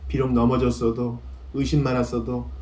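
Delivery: ordinary delivery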